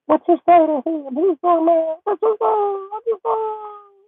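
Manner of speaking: very high, slow whimpering question; mock whiny daughter. Daughter whining at dad about what he said, high and crying. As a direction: whine it like a crying teen girl.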